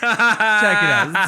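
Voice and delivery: loud, high, stretched-out CHECK IT OUT; hyped-up announcement. Real, not acted. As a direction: draw out "Check it out" loudly to hype the story. Hyped repeat to get attention.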